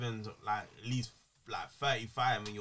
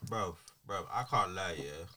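usual speaking voice

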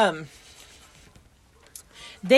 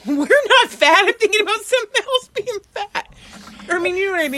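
flustered; 10 semitones higher, 7 dB louder than usual. Real, not acted. Flustered loud correction.

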